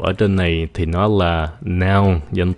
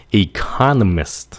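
economist slowly with CON stressed; e-CON-o-mist, slow clear model; second-syllable stress; slower than usual. Shown as a demonstration. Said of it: "Nhấn âm con".

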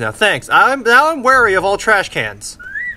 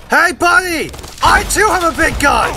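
cocky shouted challenge; mock taunt shout; 10 semitones higher than usual. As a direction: bellow it like a cocky brawler. Taunting enemy with big gun.

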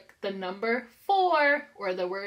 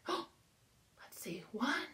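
gasp, then whisper the count; breathy playful whisper, no voice at all; 15 dB quieter, much slower than usual. Acted. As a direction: hush it like a secret for the kids. Secret-style counting for children.

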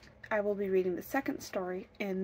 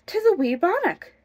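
high, louder cry of delight; 10 semitones higher, 9 dB louder than usual. A narrator's reading. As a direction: exclaim it with delight, pitched up. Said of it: "she cried in delight".

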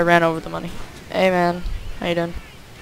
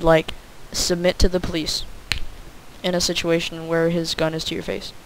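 ordinary talk

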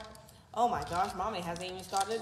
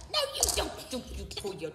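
stern scolding; 4 semitones higher than usual. Real, not acted. Mom scolding child climbing.

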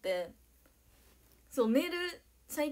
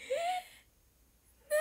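teary gasp. Real, not acted. Overwhelmed, near tears.

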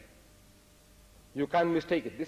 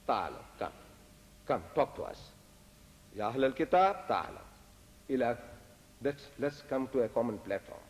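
soft, low coaxing; hushed, conciliatory appeal; gentle invitation; 5 semitones lower, 5 dB quieter than usual. Retold or imitated. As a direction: invite them over quietly, with pauses. Voicing Islam's invitation to talk.

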